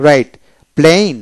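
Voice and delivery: ordinary talk, nothing marked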